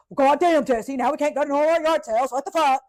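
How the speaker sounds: grumble-yell like a put-out grandpa; exasperated grandpa. Retold or imitated. Grandpa cussing at the bleeding granddaughter, loud exasperated gripe.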